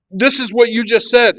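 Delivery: high, loud exasperated insistence; frustrated insisting. Retold or imitated. Husband replaying the verse to wife, exasperated. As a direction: push the point, fed up. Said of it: "now I'm furious".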